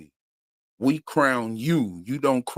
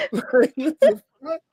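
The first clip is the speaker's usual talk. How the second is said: laughing disbelief; 10 semitones higher than usual. Real, not acted.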